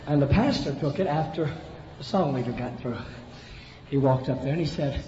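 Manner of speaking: normal talking voice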